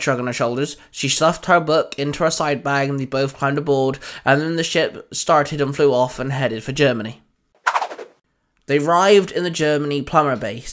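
plain reading voice

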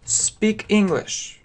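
ordinary talk, nothing marked

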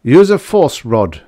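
loud, slow commanding order; firm order. Acted. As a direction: give the command firmly. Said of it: "Fisk ordered".

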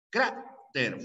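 Cráter split up, stressed on CRÁ; stress on penultimate syllable. Shown as a demonstration. Teacher showing a palabra grave, Cráter broken up with stress on CRÁ. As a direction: hit the first syllable of Cráter, then pause before TER. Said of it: "fuerza de voz".

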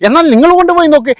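imitating a curt family member daring others to take the boy; dismissive retort. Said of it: "അപ്പോഴൊക്കെ എന്താ പറഞ്ഞ്?".

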